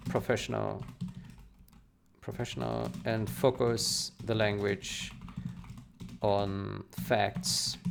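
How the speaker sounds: slow, halting dictation voice, word by word; dictating. Real, not acted. Developer dictating a reply to a reviewer, slow and halting. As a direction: spell out a reply to a speech-to-text app, pausing often.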